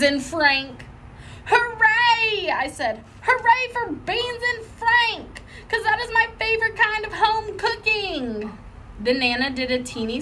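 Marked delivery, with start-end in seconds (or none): 1.5-2.5 put-on high, bright child's cheer; excited cheer. Little girl cheering for her favorite dinner, excited. 3.2-8.6 excited. Acted. Junie gushing to Nana about dinner, high and gleeful.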